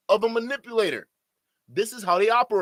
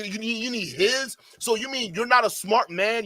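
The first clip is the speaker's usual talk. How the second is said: ordinary delivery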